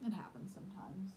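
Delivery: unvoiced aside; hushed whisper. Real, not acted. Child nearby, voice drops.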